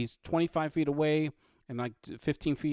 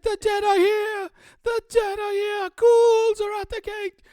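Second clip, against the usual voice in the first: very high, loud alarm cries; screaming guard; 9 dB louder than usual. Acted. Fleeing guard warns the townspeople, screaming high and loud. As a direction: scream the alarm through the town. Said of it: "ran and screamed".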